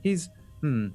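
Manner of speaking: high, halting raccoon voice; monocled raccoon. Acted. Raccoon apologizes for his brother, halting and polite. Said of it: "the raccoon turns to each of you".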